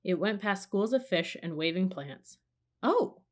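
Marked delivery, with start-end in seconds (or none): 2.8-3.2 sharp high gasp of surprise. A narrator's reading. Girl gasps at giant eyes behind a plant, high gasp. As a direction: Annie startled by eyes in the plants, gasping. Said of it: "gasped Annie".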